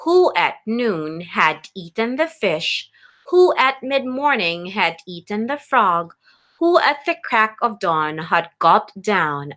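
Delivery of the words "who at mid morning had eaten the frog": usual speaking voice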